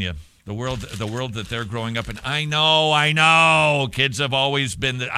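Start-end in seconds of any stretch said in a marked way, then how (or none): 2.2-3.9 loud, raised, drawn-out protest; head off the objection loudly; exasperated concession. Real, not acted. Host fending off listeners' objection about kids, loud exasperated concession.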